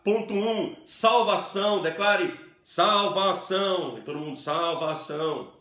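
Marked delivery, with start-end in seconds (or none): 0.0-1.8 loud, high-pitched call of "salvação"; raised preaching declaration. Shown as a demonstration. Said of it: "A hora certa de você levantar sua voz e declarar". 1.8-4.0 loud, drawn-out, slow "salvação"; raised declaration. Shown as a demonstration. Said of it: "levantar sua voz e declarar".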